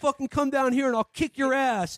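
high, strained mock challenge; mock tough-guy challenge. J D calling Ray out for insulting his mom, high mock bluster.

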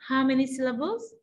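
usual speaking voice

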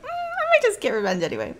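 high, hummed mock musing that slides down; imitated indifference. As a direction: parody her shrugging it off, starting high. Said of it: "She's like".

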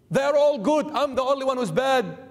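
usual speaking voice

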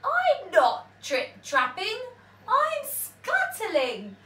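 high, loud little spider voice, slowing on scuttling; tiny spider. A narrator's reading. Tiny spider answering the troll, high squeaky voice.

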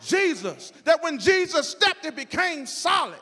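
loud, high, driving sermon delivery; full-force preaching. Real, not acted. Preacher urging congregation that Jesus can handle it, full-force preaching.

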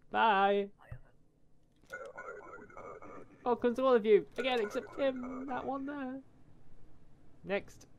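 high, loud gloating farewell to the crowd; sing-song jeer at the one left out; mocking taunt. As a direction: rub it in, way up high. Gleefully taunting rejected border entrant.

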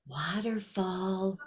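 soft, slow, barely there; quiet waterfall. Shown as a demonstration. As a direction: say it softly and slowly. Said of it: "barely a waterfall".

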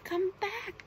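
high-pitched calling out; storybook boy's high plea; crying out. A narrator's reading. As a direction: call after them, voice lifted high. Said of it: "cried the boy".

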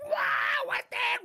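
a jolt of alarm as the spider strikes; startled shout. Acted. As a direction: blurt it out in sudden shock. Caught by spider attack.

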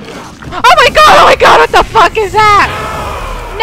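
very high, loud screaming; terrified scream. Real, not acted. Streamer seeing a scary monster, screaming. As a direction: shriek in real terror. Monster appears in horror game.

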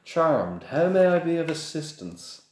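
high, fawning shopkeeper voice; bow and scrape to the customer; oily shopkeeper. Acted. Shopkeeper greeting a wealthy customer, fawning.

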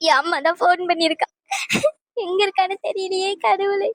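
tearful wail to God; crying. Acted. Daughter unsure where her mother is, crying. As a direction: sob through the words, pitch climbing. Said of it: "நீ ஏன் இப்படி அழுதுகிட்டு இருக்காதம்மா".

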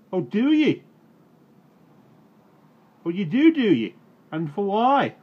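very high, loud mocking sneer; sarcastic taunt. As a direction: jeer at the excuse.

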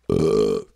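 loud, low burp. Real, not acted. Streamer burps mid-stream, loud burp. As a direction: let out a big low belch. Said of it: "Add to the burp counter".

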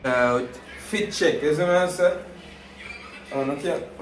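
ordinary talk

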